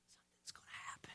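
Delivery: breathless whisper of suspense. Acted. Preacher voicing the waiting crowd, whispered. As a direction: whisper it like the whole stadium holds its breath. Crowd's unspoken thought, hushed.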